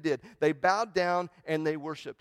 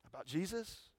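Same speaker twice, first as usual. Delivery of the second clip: ordinary talk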